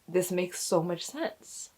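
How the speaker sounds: soft, slow, choked-up delivery; holding back tears. Real, not acted. Mother telling of her baby girl, holding back real tears. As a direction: speak slowly and quietly to keep from crying. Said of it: "I'm trying not to cry right now".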